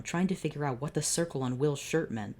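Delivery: usual speaking voice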